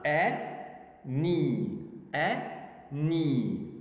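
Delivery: "E, ni" as two separate beats; split into syllables. Shown as a demonstration. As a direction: break "any" into e, pause, ni. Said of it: "two vowel sounds".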